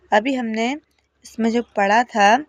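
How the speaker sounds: ordinary talk, nothing marked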